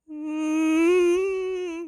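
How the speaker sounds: long, high, loud "mm"; emphatic disagreeing hum. Real, not acted. Commentator rejecting the idea he'd reach out, emphatic. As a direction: hum a drawn-out, rising-pitched objection.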